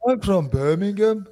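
a Brummie voice; Birmingham accent. Shown as a demonstration. Playing a Birmingham local, Brummie accent. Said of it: "That's how they talk".

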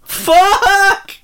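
sudden high yell; shout. Real, not acted. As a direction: blurt it out loud. Screamed in-joke exclamation.

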